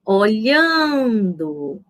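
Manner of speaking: Olhando very slow, swelling on a long LHAN; teacher stretching o-LHAAAN-do; stress on middle syllable. Shown as a demonstration. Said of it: "qual é a sílaba mais forte".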